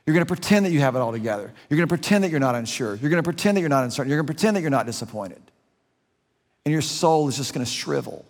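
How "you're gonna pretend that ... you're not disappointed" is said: rapid-fire repetition, building pressure; quick preacher's list; fast repeated run. Real, not acted. Preacher driving home a point.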